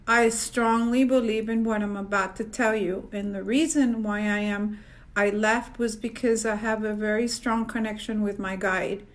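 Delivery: normal talking voice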